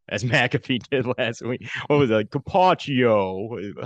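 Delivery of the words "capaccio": put-on slow, drawn-out Italian accent; mock Italian accent, stretched out; bad Italian accent. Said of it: "bad Italian accent to pronounce your name".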